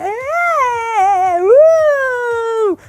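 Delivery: very high, drawn-out creature coo; Baby Yoda squeal. Acted. Host voicing Baby Yoda among green Orbeez, squealing. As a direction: squeal it like a little alien. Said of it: "He's like".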